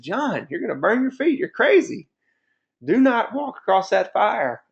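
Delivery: high, loud, panicky mock plea; alarmed warning. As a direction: beg him not to cross the fire, voice up high. Alarmed warning to father-in-law.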